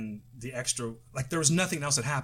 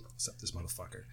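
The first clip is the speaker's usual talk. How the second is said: muttering; 5 semitones lower, 13 dB quieter than usual. Pretend. Muttered while waiting on call.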